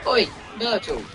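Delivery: a high, hammed-up bellow across the room. A narrator's reading. Peeves bellows to summon Myrtle, mock yell. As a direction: call her name out as a prank. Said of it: "He took a deep breath and bellowed".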